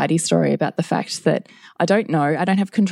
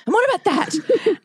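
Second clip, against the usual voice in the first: much higher, piling-on mock scolding; nagging challenge; 14 semitones higher than usual. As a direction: nag in a high, accusing pitch. Parent nagging, acted out.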